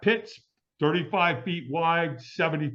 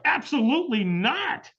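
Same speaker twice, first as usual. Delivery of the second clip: slow, high, drawn-out refusal; emphatic refusal; 9 semitones higher than usual. Retold or imitated. Firewalk sceptic turning down a stranger, emphatic refusal. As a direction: refuse outright, stretching every syllable.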